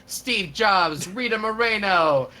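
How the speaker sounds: normal talking voice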